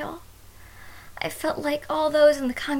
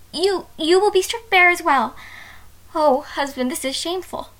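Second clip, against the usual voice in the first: shocked exclamation; 3 semitones higher, 5 dB louder than usual. Acted. Bride to husband on their wedding night: scandalised. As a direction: gasp it out, truly scandalised.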